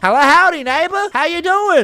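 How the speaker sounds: loud, high, hearty hail; calling out. Acted. Visitor arriving for dinner at neighbor's, calling out. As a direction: holler a friendly greeting up to the house.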